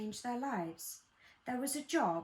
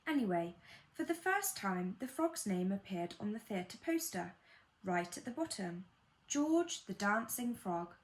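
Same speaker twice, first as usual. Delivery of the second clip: usual speaking voice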